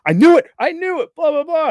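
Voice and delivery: high, loud burst of glee; mock-thrilled kid yelling he was right; excited kid. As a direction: squeal it like a thrilled fan kid. Kid's thrilled quote.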